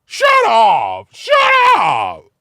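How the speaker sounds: very high, loud shout. Retold or imitated. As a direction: shriek shut up like the clerk. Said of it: "I'm a little more animated now".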